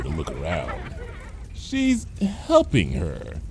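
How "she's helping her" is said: slowly. Acted. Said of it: "Cheerilee said slowly".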